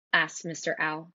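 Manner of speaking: usual speaking voice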